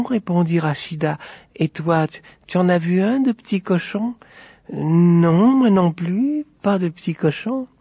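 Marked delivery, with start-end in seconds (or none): 1.5-7.7 put-on high, innocent child voices; sheepish little girls. Two sisters feign ignorance to each other, innocent voices. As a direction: play dumb in a high child's voice.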